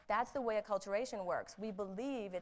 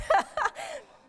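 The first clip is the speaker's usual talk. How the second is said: real, loud embarrassed laugh; self-mocking laugh; high burst of laughter; 10 dB louder than usual. Real, not acted. Laughs at own failed marriage.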